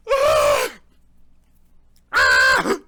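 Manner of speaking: short, loud cries of pain; sudden loud yelp from the pepper burn; pained scream. Real, not acted. Reviewer burning from a habanero, real pained yells.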